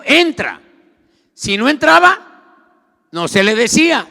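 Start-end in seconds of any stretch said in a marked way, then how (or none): 0.0-0.6 loud, higher one-word command; the Lord commanding. Acted. Preacher voices the Lord ordering Saulo, loud command. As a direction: order it forcefully as God. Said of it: "El Señor le dijo".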